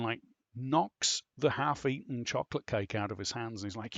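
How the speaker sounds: normal talking voice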